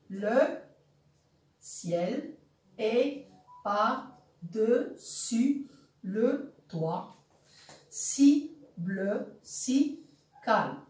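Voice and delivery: vers scandé très lentement; détache chaque syllabe, avec une pause entre elles; syllabe par syllabe. Shown as a demonstration. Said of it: "on va compter les syllabes dans le premier vers". (découper le vers pour compter)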